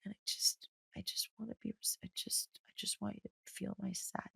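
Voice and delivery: hushed, breathy whisper of a sad friend; imitating crying friend. Quoting a crying best friend on the phone, whispered. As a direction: whisper it like a friend confessing through tears. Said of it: "called me yesterday crying and being like".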